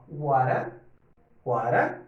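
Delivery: "wara" with the T as a quick tapped R; flapped T demo. Shown as a demonstration. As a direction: tap the T like a Portuguese R in "wara". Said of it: "um T com som de R".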